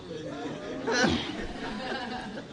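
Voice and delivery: voiceless wheezing laugh; breathless silent laugh. Real, not acted. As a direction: laugh without voice. Laughs at the punchline.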